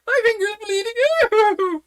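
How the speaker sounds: very high, loud, slow scream; screaming queen. A narrator's reading. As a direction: shriek it like a steam whistle. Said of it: "shouted the Queen".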